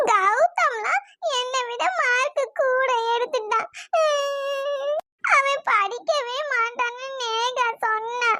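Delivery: drawn-out tearful wail; crying. Acted. Daughter tells mother a boy outscored her in marks, crying. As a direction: sob out the grievance. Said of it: "எதுக்கு அழுவுறனாவது சொல்லிட்டு அழுவு".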